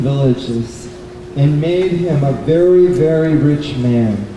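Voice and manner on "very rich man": ordinary delivery, nothing marked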